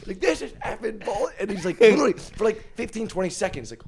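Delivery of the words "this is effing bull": high-pitched retold gamer complaint; mock raging. Roommate raging at the TV, high mock complaint. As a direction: imitate a roommate's rage at the screen. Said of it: "He's raging at the T V".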